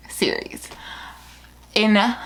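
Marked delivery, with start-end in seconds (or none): 0.1-0.7 whispered aside. Real, not acted.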